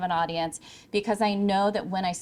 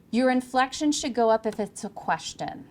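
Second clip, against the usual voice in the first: ordinary talk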